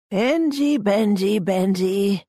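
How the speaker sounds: high, drawn-out name calling; patronising coaxing. A narrator's reading. Engo steps in to coax Ben, patronising. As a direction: stretch the nickname out, stepping down in pitch.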